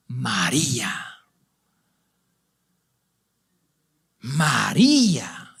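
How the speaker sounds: slow, breathless whisper of her name. Acted. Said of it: "en exaltación". (Jesús llama a María en un susurro lento y emocionado)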